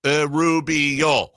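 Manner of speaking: Irubio drawn out slowly, one syllable at a time. Shown as a demonstration. Host testing syllable counts on fingers, Irubio said syllable by syllable. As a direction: stretch Irubio out so each syllable can be counted. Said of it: "Count on your fingers every syllable".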